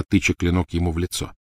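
ordinary delivery

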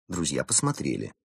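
ordinary delivery, nothing marked

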